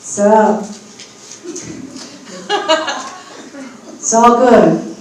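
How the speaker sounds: put-on loud, casual young man; channeling a spirit. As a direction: speak as the departed friend, relaxed and loud. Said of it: "Their name is T J".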